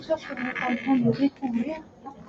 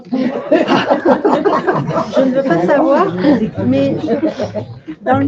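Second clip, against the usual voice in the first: laughing; 6 dB louder than usual. Real, not acted. Laughing while saying she won't pry.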